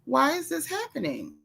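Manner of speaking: hushed, high-pitched mock bewilderment; retold disbelief. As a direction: relive being baffled by what you saw. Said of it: "I couldn't believe this one".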